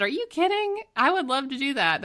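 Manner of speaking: high-pitched, delighted disbelief; gushing retold excitement; thrilled acceptance. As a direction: leap up in pitch, overjoyed by the offer. Retold reply to keynote invitation.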